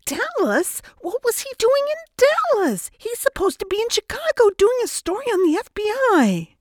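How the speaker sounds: very high, hysterical screech. A narrator's reading. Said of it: "she screeched beyond rational thought".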